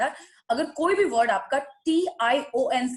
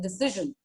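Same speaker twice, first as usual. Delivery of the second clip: Decision as di-SIZH-un, short i; weight the short SIZH in decision; stress on second part; 4 semitones lower, 5 dB quieter, slower than usual. Shown as a demonstration. Coach gives student an example, di-SIZH-un for decision. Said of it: "second part stress है".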